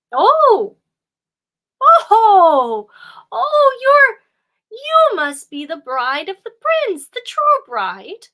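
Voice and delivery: put-on loud, high, thrilled old woman; excited exclamation. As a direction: gasp it out in delight. Old woman recognizes the bride.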